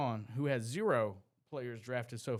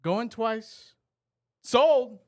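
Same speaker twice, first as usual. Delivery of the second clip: loud, high auctioneer calls; auctioneer style; 9 semitones higher, 11 dB louder, much slower than usual. Pretend. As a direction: bang the gavel, going once, twice, sold. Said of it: "I'm gonna do this, uh, auctioneer style".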